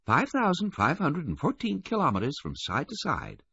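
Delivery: high, prim woman's voice. A narrator's reading. Missus Baudelaire giving Canada's width in kilometers, high and prim. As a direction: recite it primly in a high register. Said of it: "she said primly".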